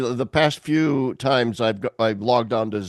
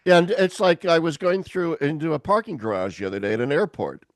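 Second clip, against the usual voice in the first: normal talking voice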